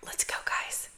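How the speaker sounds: soft unvoiced whisper. Real, not acted. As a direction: whisper it to the viewers. Whispered aside.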